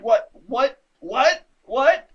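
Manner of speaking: mock panicked whats, high and loud; imitated freak-out, each one jumping up. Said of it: "she's freaking out".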